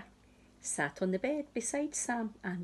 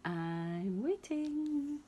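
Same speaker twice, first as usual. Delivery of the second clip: slow high sing-song; drawn out, higher childish chant; sing-song waiting; 7 semitones higher, 3 dB quieter, much slower than usual. Acted. Sam waits.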